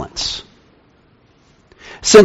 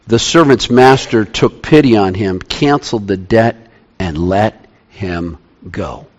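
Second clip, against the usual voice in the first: hushed and tender; 8 semitones lower, 5 dB quieter than usual. Acted. Tender retelling of the pardon.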